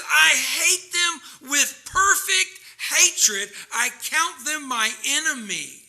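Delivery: high, loud, drawn-out declaration; righteous anger. Acted. Psalmist declares hatred of God's enemies, righteous anger. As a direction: weigh each word with preached fury. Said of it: "It's righteous anger".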